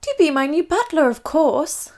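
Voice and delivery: lightly voiced Queen, matter-of-fact; a hint of regal announcement; announcing Queen. Queen announcing to her family. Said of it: "the Queen announced".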